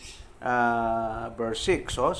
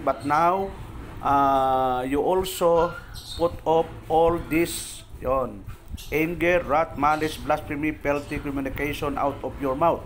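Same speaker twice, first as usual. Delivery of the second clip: scripture reading; slower than usual. Acted. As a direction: read the verse out to the group, deliberately. Reads scripture verse aloud.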